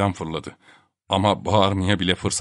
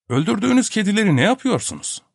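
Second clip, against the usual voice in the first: numb; 11 semitones higher than usual. A narrator's reading. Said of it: "Donuk bir sesle sordu".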